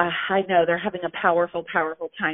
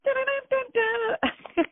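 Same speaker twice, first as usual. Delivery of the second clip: hummed tune with laugh. Retold or imitated. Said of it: "that old song".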